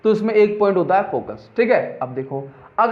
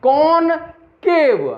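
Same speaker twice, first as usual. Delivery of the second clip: Concave stretched very slowly and pitched high; drawn-out emphasis; 7 semitones higher, much slower than usual. Shown as a demonstration. Teacher stressing the word for students, long and high. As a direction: drag out concave high so the cave sticks. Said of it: "concave mirror में हमेशा याद रखना".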